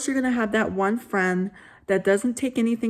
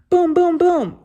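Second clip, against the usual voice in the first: knocking sound; 9 semitones higher, 7 dB louder than usual. Acted. Knocking on the door.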